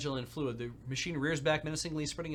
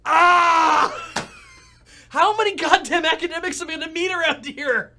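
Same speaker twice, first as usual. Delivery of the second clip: much higher, loud cry of mock despair; real fed-up outburst at the table; exasperated shout; 17 dB louder, slower than usual. Real, not acted. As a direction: throw your hands up and yell it. Player exasperated at another academic.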